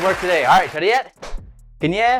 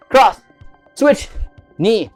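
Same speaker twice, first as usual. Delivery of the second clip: loud, higher calls; shouted drill commands; 4 semitones higher, 5 dB louder, much slower than usual. Shown as a demonstration. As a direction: bark out each strike. Calling out combo.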